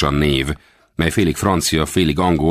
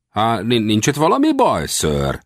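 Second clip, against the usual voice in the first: doubting his sanity; 8 semitones higher than usual. A narrator's reading. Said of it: "ujjával homlokát tapogatva".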